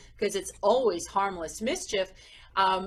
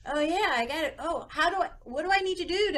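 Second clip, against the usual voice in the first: imitating a child; 7 semitones higher, faster than usual. Children's voice, trying to fit box.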